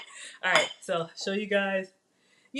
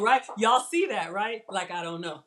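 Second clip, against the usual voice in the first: ordinary talk, nothing marked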